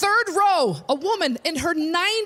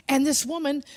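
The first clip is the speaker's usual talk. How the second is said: usual speaking voice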